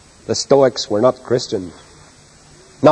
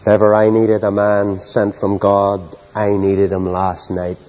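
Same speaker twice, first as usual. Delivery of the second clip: sincere hush; 6 semitones lower, slower than usual. Real, not acted. Preacher confessing his own need to congregation, low and sincere. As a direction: confide it gently. Quiet confession after story.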